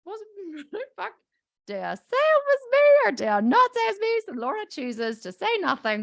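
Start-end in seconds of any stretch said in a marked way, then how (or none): 0.1-1.1 very soft, high guilty muttering; hushed panic. Retold or imitated. Laura caught out over soap containers, tiny guilty voice. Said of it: "Laura goes". 1.7-4.2 high-pitched, dithering inner debate; mock panic. Laura deciding whether to confess, comic high-pitched panic. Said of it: "Laura goes".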